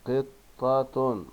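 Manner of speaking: "قطة" very slowly as "qit... taa-tun"; tanween damma ending. Shown as a demonstration. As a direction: sound out قطة with a pause after qit. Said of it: "الكلمات التالية بالضم".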